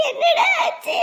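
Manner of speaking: panicked cry. Acted. Mother panics at son crying.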